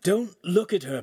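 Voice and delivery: high-pitched shouted warning; mentor's urgent high call. A narrator's reading. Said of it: "Pan shouted".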